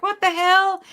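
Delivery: ordinary talk, nothing marked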